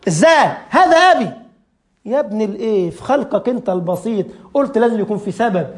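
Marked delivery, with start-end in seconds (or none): 0.1-1.3 indignant atheist. Retold or imitated. The atheist protecting his father's name, mock indignant. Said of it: "يقول لك".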